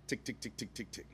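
quiet, rapid mechanical ticks; small clicking engine imitation; imitated failed-ignition ticking. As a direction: tick evenly like a dead starter. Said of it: "It goes tick".

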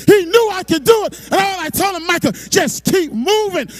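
loud, rising sermon build; full-force preaching. Acted. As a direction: preach it to the back row.

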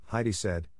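ordinary delivery, nothing marked

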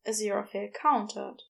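ordinary delivery, nothing marked

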